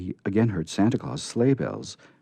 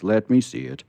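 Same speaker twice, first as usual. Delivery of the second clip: Santa voice; 5 semitones higher, 6 dB louder than usual. Acted. Santa taking charge.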